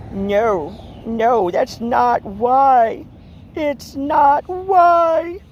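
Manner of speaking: slow and high, stretching every word; over-the-top slow protest at the cat; dramatically drawn-out no, that's not why; drawn-out insistence. Acted. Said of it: "Am I being a bit much right now?".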